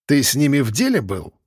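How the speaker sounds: ordinary delivery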